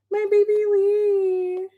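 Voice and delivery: high, slow, affectionate coo; doting coo. Real, not acted. Streamer fussing over a dear friend, doting coo.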